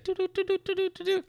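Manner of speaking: high, steady beeping tones; telegram beeps. Acted. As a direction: imitate a telegram signal. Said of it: "Was that a telegram?".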